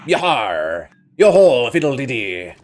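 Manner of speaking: rowdy pirate shouts; hollering like a jolly pirate; pirate cry. Acted. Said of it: "my amazing pirate voice".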